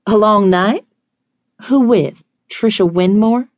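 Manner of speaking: high, pointed, jealous questions; jealous, incredulous echo of his words; jealous questioning. A narrator's reading. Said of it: "That a jealous note I hear there".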